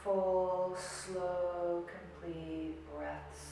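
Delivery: soft, slow meditation voice with long pauses; guided meditation. Acted. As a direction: ease the listener into stillness, softly and slowly. Leads a seated meditation.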